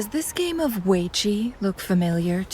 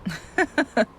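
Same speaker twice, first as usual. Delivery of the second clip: short, amused laugh; light chuckle; 4 semitones higher than usual. Acted. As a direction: chuckle lightly at being found out. Amused at being found out.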